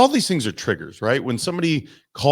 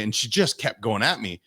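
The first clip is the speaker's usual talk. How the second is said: usual speaking voice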